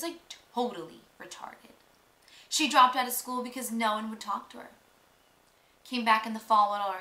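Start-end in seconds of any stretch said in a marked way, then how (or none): none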